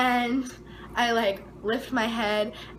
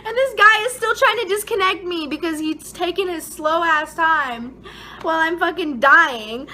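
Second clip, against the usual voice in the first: exasperated; 6 semitones higher, 6 dB louder than usual. Real, not acted. Annoyed at slow nurse.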